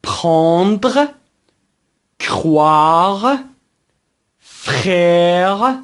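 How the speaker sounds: پراندر، کروار، فرر drawn out with French r; very slow, emphatic French پراندر، کروار، فرر; repeat-after-me model. Shown as a demonstration. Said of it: "به دنبال بنده تلفظ کنید".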